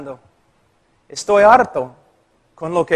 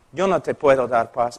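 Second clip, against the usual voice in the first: quiet firm pastor; 7 semitones lower, 5 dB quieter than usual. Retold or imitated. Pastor repeating the refusal to the woman, low and soft. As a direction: let the refusal sink in quietly.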